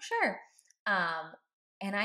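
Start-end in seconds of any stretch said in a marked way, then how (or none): 0.0-0.4 high, bright-eyed "sure"; a quick, much higher yes; eager yes. Retold or imitated. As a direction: jump at the offer with a high yes. Offering lunch, eager yes.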